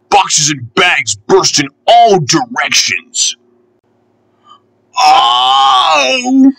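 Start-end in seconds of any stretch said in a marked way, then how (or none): 5.0-6.5 long, high-pitched "oh" of dismay; high wailing groan. A narrator's reading. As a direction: wail it out high and drawn out. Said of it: "groaned Percy".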